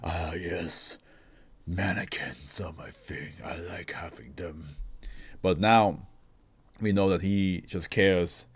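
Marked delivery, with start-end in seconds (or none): 0.0-4.8 slow, much softer mock-creepy voice; playfully spooky weirdo impression. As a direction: hush it like a creepy mannequin collector. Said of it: "some weirdo who's like".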